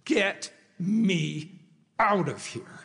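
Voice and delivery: put-on homesick teen's plea. Teen phoning his mother from camp, imitated emphatic demand. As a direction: imitate a boy demanding rescue, word by word. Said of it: "called his mother and he said".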